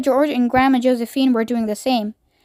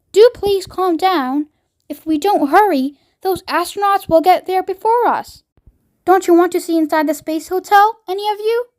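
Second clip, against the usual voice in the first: put-on pleading boy voice; 6 semitones higher than usual. Charlie pleading.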